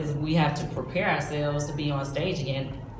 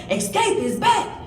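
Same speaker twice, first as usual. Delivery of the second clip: higher, slow hype-man call; drawn-out, higher mock announcement; hyped announcer; 12 semitones higher, 5 dB louder than usual. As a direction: announce it like a big reveal. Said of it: "and he was like".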